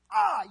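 loud, high groan of regret; crying out in regret, loud and high; anguished groan. Shown as a demonstration. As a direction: let out a pained "ah". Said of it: "It means".

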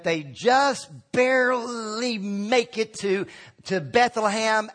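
slow, laboured delivery, as if struggling to arrive; drawn-out straining. Acted. Preacher mocking the nativity plays, dramatically drawn out. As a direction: stretch BARELY out very long and slow.

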